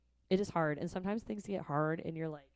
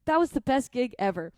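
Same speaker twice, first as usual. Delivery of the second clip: elated; 6 semitones higher, 7 dB louder than usual. Retold or imitated. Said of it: "I'm like".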